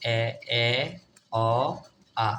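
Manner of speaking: এ, অ, আ each said separately; vowel sound drill. Shown as a demonstration. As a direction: sound out এ, অ, আ with pauses. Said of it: "এটার উচ্চারণ কি হবে?". (এ, অ, আ থেমে থেমে উচ্চারণ)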